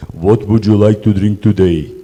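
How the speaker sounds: blunt, rude waiter tone. Shown as a demonstration. Waiter asks guest rudely, blunt and curt. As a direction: ask it curtly, like a rude waiter. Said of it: "I'll scare them".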